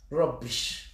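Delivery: low, quiet dismissal; dismissive. Real, not acted. Ranter dismissing her targets, low dismissive aside. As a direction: toss it off low and soft.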